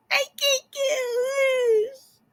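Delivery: high cry-shout for help; hammed-up stuck kid yelling high; struggling against restraints. Kid stuck to board screaming.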